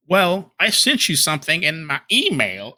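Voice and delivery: high, silly put-on accent; mock him in a wandering high-pitched accent; Phil impression. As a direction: caricature accent of a whining complainer. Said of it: "I think I've changed his accent again".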